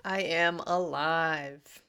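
soft, slow awed echo; hushed, slow and reverent; reverent whisper. Acted. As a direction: savour the words quietly. Friend echoes the phrase reverently.

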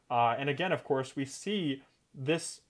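normal talking voice

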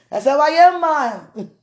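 bursting out with it; loud insistence. Real, not acted. To wife, loud high playful insistence.

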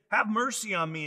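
ordinary talk, nothing marked

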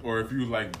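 normal talking voice

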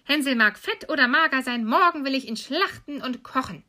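high, loud commanding witch; impatient witch. Acted. Witch who will wait no longer, impatient orders. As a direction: order her about, out of patience. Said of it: "da übernahm sie die Ungeduld".

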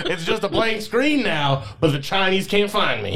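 high Southern voice, laughing; proud paranoid hillbilly; Southern conspiracy theorist. Acted. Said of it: "conspiracy theorist voice is a Southern accent".

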